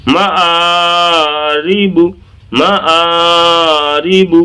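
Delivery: slow chant of معارب with long aa and ree; stretched recitation. Shown as a demonstration. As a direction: hold the aa in معارب, finish on short bu. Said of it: "الفاظ کو کھینچ لیں".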